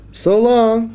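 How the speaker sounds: high loud little fish farewell; fish yelling goodbye. Acted. Fish calling farewell to the boy from the lake, high and loud. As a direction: shout goodbye from the water, small and high. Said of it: "the fish yelled back".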